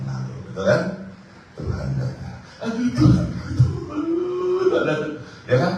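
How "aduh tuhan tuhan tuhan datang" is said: high, loud alarmed cry of the Lord's name; panicked cry. Acted. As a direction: yelp in alarm as the Lord comes. Demon panics as Jesus arrives.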